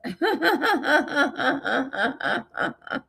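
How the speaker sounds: long high giggle trailing away; giggling fit. Real, not acted. Remembering mom enjoying her joke, giggling at length. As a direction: giggle on and on until it fades.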